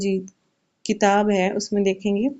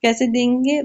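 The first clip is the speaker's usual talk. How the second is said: ordinary talk, nothing marked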